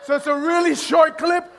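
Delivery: ordinary talk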